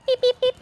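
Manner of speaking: high playful beep beep like a reversing truck. Pretend. Coach playfully backing a gymnast into place, high beeps.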